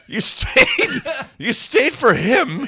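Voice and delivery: cracking up while talking; words swallowed by laughter; laughing hard. Real, not acted. Laughing through the punchline.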